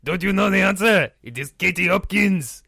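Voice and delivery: put-on high-pitched angry scolding in a French accent; heated outburst. Interviewee berates his partner over the answer, heated outburst. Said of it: "I got very excited there".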